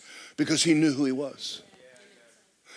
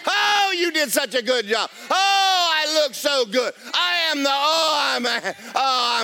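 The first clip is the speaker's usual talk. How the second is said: exuberant self-praise; 9 semitones higher than usual. Pretend. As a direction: crow over yourself like you won a prize. Mock boastful mirror self-talk.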